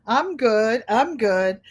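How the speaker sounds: ordinary talk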